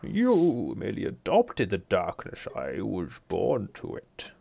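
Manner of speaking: slow, muffled movie villain voice; Bane impression. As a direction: do the film's Bane, slow and menacing. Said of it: "get out your system now".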